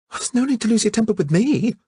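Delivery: squeak. A narrator's reading. Phyllida protesting to the angry Bostock, high squeak. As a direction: squeal it out, affronted. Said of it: "squeaked Miss Lee".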